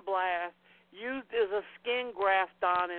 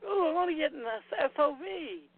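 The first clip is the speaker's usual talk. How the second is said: higher, quick imitation of excitement; mock eager newcomer; 6 semitones higher, faster than usual. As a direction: gush like a keen beginner, in imitation. Mimics eager newcomer to SOV.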